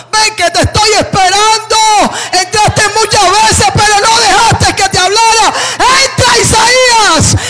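high, loud shouted call of God; God's voice ringing through the temple, shouted. Acted. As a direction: cry out the invitation from heaven as God. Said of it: "Dios le decía así en el templo".

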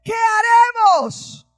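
alto, lento y angustiado, clamando; grito asustado. Retold or imitated. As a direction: implora como criado aterrado y sitiado. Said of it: "Este hombre llegó gritando". (criado asustado grita al profeta, agudo y lento)

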